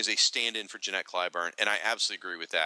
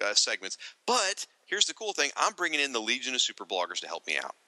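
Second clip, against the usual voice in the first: ordinary talk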